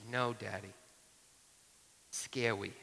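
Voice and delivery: put-on quiet toddler refusal, "scary" said like "skeh-wy"; toddler's soft R. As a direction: say "scary" with the R turned to W. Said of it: "she can't really say ours".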